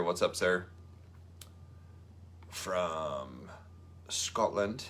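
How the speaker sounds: a mock Scottish accent, lightly done; bad Scottish accent. Greeting Andrew Murray from Scotland in a bad Scottish accent. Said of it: "that's a terrible accent".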